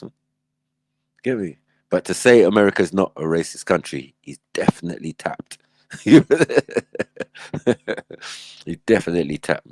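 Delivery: ordinary talk, nothing marked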